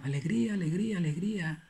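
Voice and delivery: fading echo. Retold or imitated. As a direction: fade each repeat into the distance. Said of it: "Así decía ... el eco".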